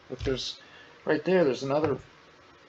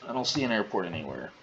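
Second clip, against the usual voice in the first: ordinary delivery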